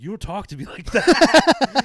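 put-on loud, high, drawn-out drunken outburst; hammed-up indignant shout; mock angry shout. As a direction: yell it as an offended drunk, half laughing. Drunk guy snapping at his girlfriend.

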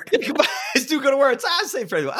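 "Goodbye" high and fast, delighted; delighted quoting. Retold or imitated. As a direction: savour the line with glee. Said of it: "I'm like".

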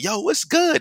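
high-pitched mock catcall; high, hammed-up holler; catcalling women. Acting out women's forward come-on.

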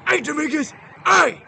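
imitated drill instructor's loud, high call; drill instructor hail. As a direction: shout for the recruit's attention. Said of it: "you know who your drill instructor is".

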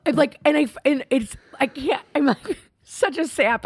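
high, halting, half-laughing emotion; choked up. Real, not acted. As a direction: stumble over words, getting emotional. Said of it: "without getting choked up now".

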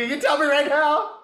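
high, loud playful demand; mock outrage. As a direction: demand an answer in mock indignation. Mock demand after being pranked.

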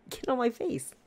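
"Get on my face" blurted high in a mock retort; slip of the tongue. Telling off the old lady in her head, high mock retort. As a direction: fling "Get on my face" out in a high mocking snap. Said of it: "in my head, I'm like".

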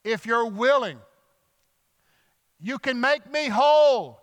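loud, high call to someone passing by; yelled plea. Retold or imitated. Leper calls to Jesus walking past, yelled plea. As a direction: cry out in desperate faith. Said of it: "he yells to him".

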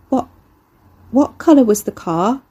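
plain reading voice

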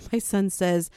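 ordinary talk, nothing marked

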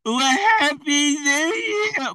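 high, long-drawn New Year whoop; mock party cheer. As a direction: imitate revellers, stretching the words out high. Mimics New Year's cheer.

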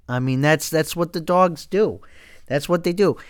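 ordinary delivery

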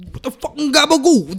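put-on loud, high mob-guy accent; Italian-American accent. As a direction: shout it like a Jersey mobster. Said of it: "that type of accent".

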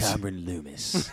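low, slow name reveal; drawn-out, low announcer voice; mock announcer. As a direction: announce the reader with mock gravity. Mock announcer introducing a segment.

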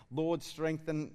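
put-on slow, drawn-out slip into a British accent; a British accent by accident when South African was meant; slipping into British. Pastor botching a South African accent, British instead. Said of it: "that's, that's British".

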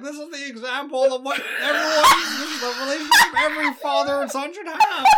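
high, loud, laughing guru voice; Jordan Peterson impression. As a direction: shout it like a proud lecturer. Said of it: "He's like, ... I can't do it 'cause my, I have, still have my sick voice".

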